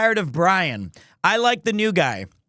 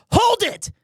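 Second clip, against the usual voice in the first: high shouted courtroom call; Phoenix Wright shout. Shown as a demonstration. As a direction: yell it like a defense attorney. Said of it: "when I say".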